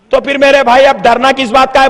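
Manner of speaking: ordinary talk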